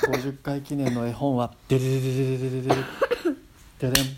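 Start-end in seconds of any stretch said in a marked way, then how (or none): 1.7-4.1 fast mouth drumroll sound; vocal drumroll. Pretend. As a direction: rattle off a drumroll. Drumroll before announcing book.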